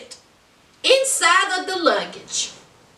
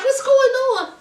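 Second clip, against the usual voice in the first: high, wailing mama impression; hammed-up high, tearful-sounding plea; mock fretting mama; 9 semitones higher than usual. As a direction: imitate a flustered mother, half laughing. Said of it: "Mama Natalie was like".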